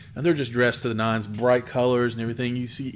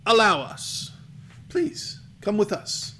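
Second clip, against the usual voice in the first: lightly acted host, pitched higher; a hint of a higher-voiced, entreating host; inviting camp host; 8 semitones higher, slower than usual. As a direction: coax the travelers in, voice lifted. Camp men inviting the party in.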